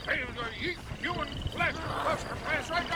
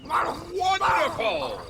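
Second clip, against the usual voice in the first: showman delight; 6 semitones higher, 5 dB louder, much slower than usual. Acted. Showman exclaims.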